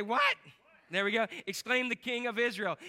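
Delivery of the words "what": high, louder "What?" for the crowd; prompted "What?". Shown as a demonstration. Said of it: "Everybody say".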